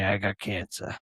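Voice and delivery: a touch of a hushed dad; father's quiet news. Retold or imitated. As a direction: softly break the bad news as dad. Father breaks news to son.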